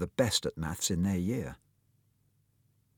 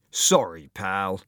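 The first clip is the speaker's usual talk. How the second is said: louder attack on a mock apology; sneering; 3 dB louder, much slower than usual. Bully who barged into Tom, sneering. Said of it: "Devlin sneered".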